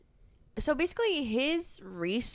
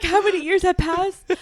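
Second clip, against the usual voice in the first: mock desperate guy; 7 semitones higher, 9 dB louder than usual. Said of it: "He's like".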